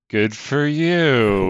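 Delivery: very slow, drawn-out sarcastic reply; unimpressed, dragging every word; Suki. Retold or imitated. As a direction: stretch it out, feigning approval. Mimics Suki's unimpressed reply.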